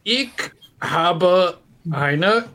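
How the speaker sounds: word-by-word beginner German; halting German presentation. Retold or imitated. Bad student bluffing through his German oral exam, halting. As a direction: leave a long gap between words, as if struggling. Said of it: "we were both terrible at German".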